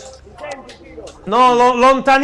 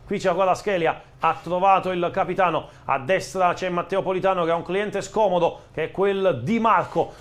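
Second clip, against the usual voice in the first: play-by-play commentary; 4 semitones lower than usual. Acted. Live play-by-play of the match.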